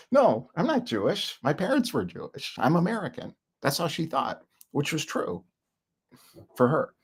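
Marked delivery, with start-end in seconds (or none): 0.1-3.3 high, lightly voiced old grandmother; a hint of a proud old lady; imitating grandmother. Grandmother insisting she's American, a hint of her high voice. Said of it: "She says".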